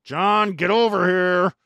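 loud, slow call across a bar. Retold or imitated. Calling a buddy over across the noisy bar, loud and slow.

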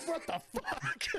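high, laughing mock disbelief; crowd disbelief. Imitating the crowd's disgust, high and laughing. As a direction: toss it off like a grossed-out crowd. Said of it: "they were like".

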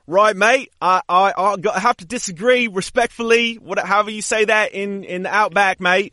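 high, badly faked Aussie accent; bad Australian accent. Co-host disagrees respectfully as from the outback, bad mock Australian. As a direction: attempt Australian and miss, pitched well up. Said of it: "Let's just both do Australian".

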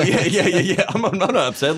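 high-pitched, giggly protest; laughing mock denial. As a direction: deny it through laughter. Mimicking denial while laughing.

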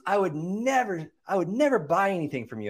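hammed-up huffy refusal in a high voice; pissed-off lady. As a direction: imitate an offended woman, stretching the refusal. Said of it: "'cause she was pissed".

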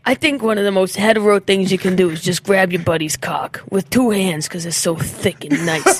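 lightly voiced frat guy; frat brother. Frat guy insisting the bonding is straight, mock-earnest. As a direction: deliver it as an earnest bro, hammed-up.

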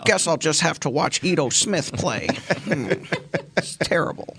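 put-on high, whiny baby voice. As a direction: whine it out like a pouty toddler. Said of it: "it's just my whiny baby voice".

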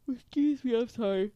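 gasping out of a yawn; catching breath after a yawn. Real, not acted. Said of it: "I'm sorry if I keep yawning a lot".

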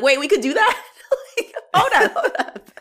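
high, loud, laughing double take; mock disbelief breaking into laughter; laughing surprise. Imagined own amazed reaction.

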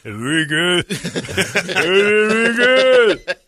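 drawn-out high mock-angry name; angry dickhead voice. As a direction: stretch the name in a loud jeering dickhead voice. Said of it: "that's my angry dickhead voice".

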